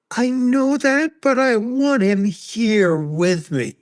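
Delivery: a mourner begging to keep the one lost; pleading grief. Retold or imitated. Grieving person answering platitudes.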